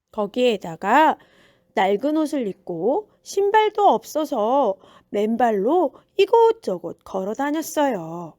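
plain reading voice

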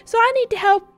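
high put-on character voice, easing off later; hammed-up appeal from a chummy dude; earnest game dude. Character asking help feeding Bruce, high hammed-up plea.

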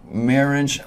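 ordinary talk, nothing marked

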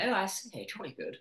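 lightly hammed jolly-good Brit accent. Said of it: "I'm not like".

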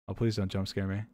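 soft, nervous begging; quietly plead, dreading the scare; scared pleading. Real, not acted. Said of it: "My heart is beating".

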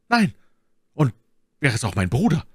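mildly fervent vow; carried away. A narrator's reading. D'Artagnan feigns zeal for Milady, mildly fervent. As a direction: swear it with a touch of enthusiasm. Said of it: "als risse ihn die Begeisterung fort".

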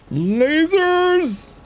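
high, drawn-out cry; gleeful exclamation. Pretend. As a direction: sing out the word with glee. Gleeful call at laser weapon.

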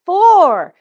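big loud high call of Four; bright shouted answer; loud excited answer. Shown as a demonstration. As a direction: shout it out to the class. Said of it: "how many beats".

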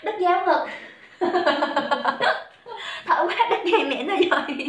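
talking through a real giggling fit; breathless laughing. Real, not acted. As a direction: laugh helplessly as the words come out. (vừa nói vừa cười ngặt nghẽo)

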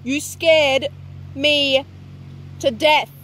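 loud, shaken cry after a scare; real fright. Real, not acted. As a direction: gasp it out, still scared. Attacked by a bird.

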